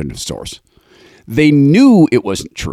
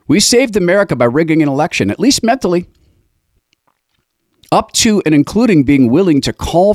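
mock boast; 6 semitones higher than usual. Mocking the censors' boast.

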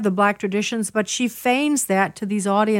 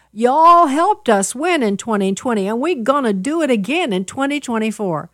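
put-on Southern accent; 2 semitones higher, 4 dB louder than usual. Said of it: "I can do a Southern accent".

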